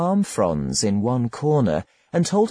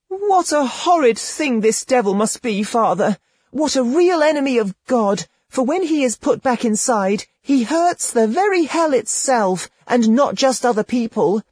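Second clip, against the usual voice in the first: put-on much higher, wondering girlish voice; girl complaining; 11 semitones higher than usual. A narrator's reading. Girl tells Rustico the devil hurts, high naive voice. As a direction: marvel at the pain like a naive girl.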